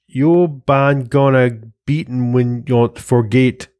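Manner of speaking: slow, hammed-up fake Swedish accent; terrible Swedish accent. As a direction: butcher a Swedish accent on purpose, slowly. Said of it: "That's a terrible Swedish accent".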